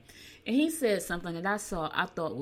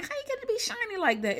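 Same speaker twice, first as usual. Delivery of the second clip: much higher, incredulous squeal of a question; mock disbelief. Host marveling at a shiny wooden ring, mock disbelief. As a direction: pitch it way up in playful disbelief.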